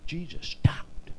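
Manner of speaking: slow, breathy whisper for the big moment; whispered, slowed-down sermon moment; hushed whisper. A narrator's reading. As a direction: drop to a whisper, let it land. Dramatic hush in the story.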